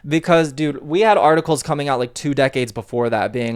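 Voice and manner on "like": ordinary talk, nothing marked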